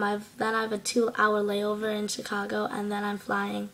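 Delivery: ordinary talk, nothing marked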